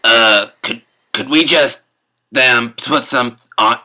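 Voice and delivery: ordinary talk, nothing marked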